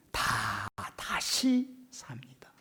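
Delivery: slow, hushed whispered declaration. Real, not acted. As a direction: drop to a whisper for effect. Preacher drops to dramatic whisper. (느리게 속삭이듯)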